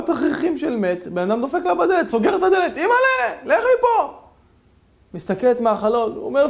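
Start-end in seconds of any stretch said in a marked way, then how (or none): 2.8-4.1 very high, loud shriek of fear; terrified scream. Acted. Wife seeing her shrouded husband at the door, shrieking in terror. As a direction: scream him away from the door.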